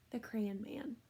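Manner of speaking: soft whispered book title. Acted. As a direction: breathe the title like a secret.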